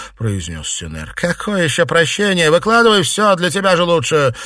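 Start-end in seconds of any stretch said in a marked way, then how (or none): 1.2-4.4 high, loud, insistent; angry demand. A narrator's reading. Mistress demanding the whole story from servant, angry demand. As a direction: dismiss the plea and demand loudly.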